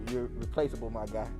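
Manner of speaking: ordinary talk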